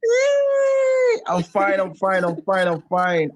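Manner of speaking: squealed high yay, then bouncy repeated fine; cheer, then insist you're fine; overjoyed reply; gleeful yay. Real, not acted. Guest gleefully answers greeting.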